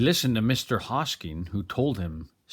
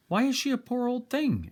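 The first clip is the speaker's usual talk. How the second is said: put-on small boy; 13 semitones higher than usual. Small boy voiced in story.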